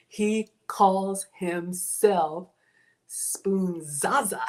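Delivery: slow, loud, drawn-out announcement; slow dramatic reveal. Real, not acted. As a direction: announce the name with ceremony. Grand announcement of name.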